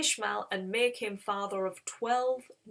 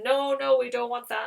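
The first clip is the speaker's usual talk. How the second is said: raised, louder retold rejection; emphatic refusal; 3 semitones higher, 7 dB louder than usual. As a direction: brush the offer aside forcefully. Mob rejects Lot's offer.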